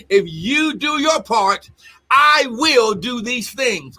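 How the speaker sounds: slow, deliberate words of God; slow solemn promise. Acted. Preacher voicing God's covenant promise, slow and deliberate. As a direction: lay out the promise slowly, weighty.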